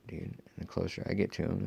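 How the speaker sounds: normal talking voice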